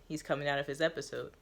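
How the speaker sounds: ordinary delivery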